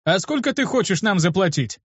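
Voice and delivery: a touch of impatience in asking; impatient. A narrator's reading. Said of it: "с нетерпением в голосе добавил". (с лёгким нетерпением)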